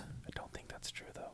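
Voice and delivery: unvoiced whisper of I don't think that's true though. Real, not acted. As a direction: breathe the words with no voice. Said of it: "Why are you whispering?".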